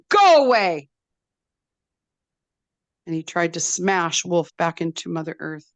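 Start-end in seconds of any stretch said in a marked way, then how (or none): 0.1-0.9 loud, high scream. A narrator's reading. Blackberry screaming at Wolf to leave, loud high scream. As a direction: scream him away. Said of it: "And Blackberry screamed".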